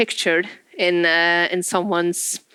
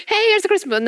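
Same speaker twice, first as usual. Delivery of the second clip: bright, high, mock-generous offer; cheery mock announcement; 13 semitones higher, much faster than usual. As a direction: sound like you are handing out a gift.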